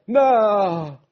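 high-pitched drawn-out shout; shouted cry. A narrator's reading. Old fisherman protesting at the shark, shouting. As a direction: cry out loudly as the old man. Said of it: "پیرمرد فریاد زد".

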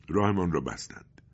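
usual speaking voice

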